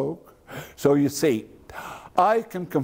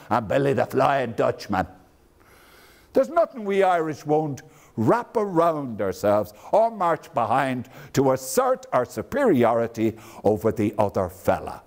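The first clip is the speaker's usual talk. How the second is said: normal talking voice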